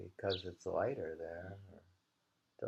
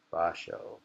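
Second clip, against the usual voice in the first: usual speaking voice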